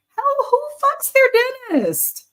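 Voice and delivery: high, slow, squealing disbelief; incredulous squeal. Real, not acted. Viewer recap, shrieking at dating a dentist.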